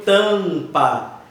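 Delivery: "Tampa" with a drawn-out nasal first A; nasalized vowel. Shown as a demonstration. Teacher demonstrating nasalization, "Tampa" with long nasal A. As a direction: hold the A of "Tampa" as if it had a tilde. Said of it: "formando essa nasalização".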